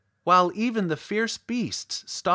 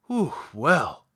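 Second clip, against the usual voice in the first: relieved sigh; 3 dB louder than usual. A narrator's reading. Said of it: "drawing a long breath of relief".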